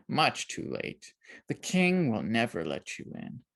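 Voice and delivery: old man mocking the bold girl, sneering. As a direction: sneer every word at her. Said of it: "The old man sneered".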